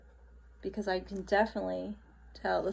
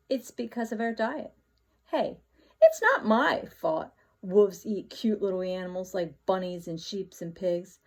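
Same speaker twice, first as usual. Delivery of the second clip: plain reading voice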